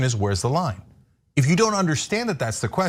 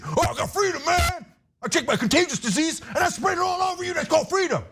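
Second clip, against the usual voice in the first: very high, shouted Southern caricature; mock Southern accent; 14 semitones higher than usual. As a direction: holler it as a hammed-up Southern simpleton. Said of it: "you just get up in the Southern accent".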